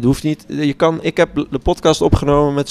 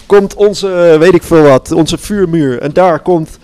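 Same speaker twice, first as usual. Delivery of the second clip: mock camp leader; 5 semitones higher, 4 dB louder, slower than usual. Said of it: "En dan zeg ik".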